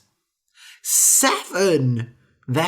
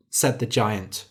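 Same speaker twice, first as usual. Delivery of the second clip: plain reading voice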